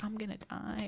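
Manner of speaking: very soft, whispered mock dread; inner voice. Pledge clapping along while secretly dreading death, whispered aside. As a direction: whisper it as a private thought. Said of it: "Meanwhile, in my head".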